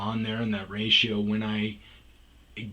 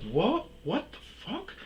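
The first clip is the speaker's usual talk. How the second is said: imitating his own stunned reaction, higher; a startled mock yelp; shocked; 11 semitones higher, 5 dB quieter than usual. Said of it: "I was like".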